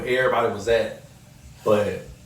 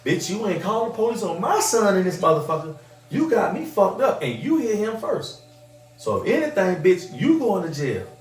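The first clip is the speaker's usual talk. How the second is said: higher, defiant mother scolding; imitating his mama; 7 semitones higher than usual. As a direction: tell her off like a fierce mom. His mama defending him, voiced.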